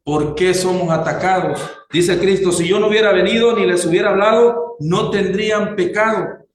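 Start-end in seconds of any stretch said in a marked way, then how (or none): 2.5-6.5 higher-pitched, emphatic verse; raised preaching voice reading scripture. Acted. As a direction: proclaim Christ's words to the congregation. Reads scripture aloud to congregation.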